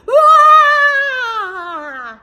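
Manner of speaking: very loud, very high terrified shriek; panicked ladies' scream; shrieking cry. Acted. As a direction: scream as you run away. Ladies flee the lion.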